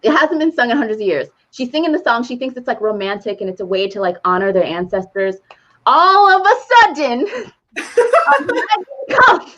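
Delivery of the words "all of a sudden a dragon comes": higher, slowed, laughing through the words. Real, not acted. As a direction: laugh out the punchline slowly. Laughing retelling dragon arrival.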